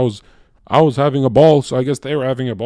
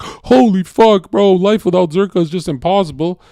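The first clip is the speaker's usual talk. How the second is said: high, loud, stretched whiny wail; hammed-up tearful despair; mock weeping girlfriend wailing holy fuck; mock crying girlfriend; 6 semitones higher, 3 dB louder than usual. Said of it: "one who actually cried live".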